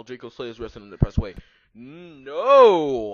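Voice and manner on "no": long, loud drawn-out cry; screamed. A narrator's reading. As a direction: wail it out in mock drama. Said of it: "I screamed sexily".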